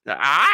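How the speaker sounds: sudden startled shout; startled yell. Retold or imitated. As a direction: yelp in shock. Acted scene, Clark startled.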